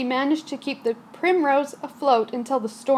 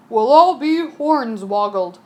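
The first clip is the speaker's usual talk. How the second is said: growling captain; 6 dB louder, slower than usual. Acted. Captain growling as the ship stalls. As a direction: growl it like an old sea dog. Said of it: "growled the captain".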